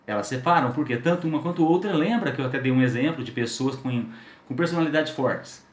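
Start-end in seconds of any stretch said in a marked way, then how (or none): none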